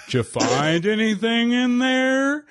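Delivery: drawn-out, high, sing-song put-on voice; exaggerated slow impression, swooping up in pitch. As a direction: stretch every word slowly in a silly impression.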